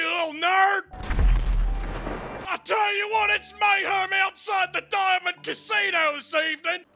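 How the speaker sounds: ordinary talk, nothing marked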